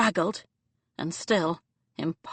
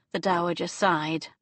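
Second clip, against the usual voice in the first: plain reading voice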